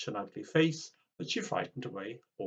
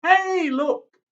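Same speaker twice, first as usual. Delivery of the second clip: much higher, louder call; excited kids; 14 dB louder than usual. Acted. Tim's friends spotting granny's motorbike, high excited call. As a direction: shout it out, pointing.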